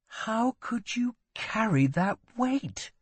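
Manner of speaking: high, slow, hushed disbelief; pained question to a brother; anguished concern. A narrator's reading. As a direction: ache for him, pitched high. Prince pained for his brother's burden.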